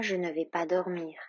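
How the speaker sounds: ordinary delivery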